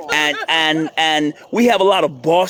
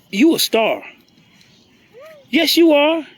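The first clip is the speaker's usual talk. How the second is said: cooing; 9 semitones higher than usual. Real, not acted. Telling a little one they are a star, cooing. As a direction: coo it high to a little one.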